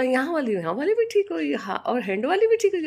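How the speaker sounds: imitating a granddaughter's excited checking; child's eager questions. Grandmother imitating granddaughter asking if wounds healed, high child voice.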